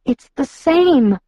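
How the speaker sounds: high, slow, urgent; urgent realization. A narrator's reading. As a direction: press the point slowly. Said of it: "Don't you see?".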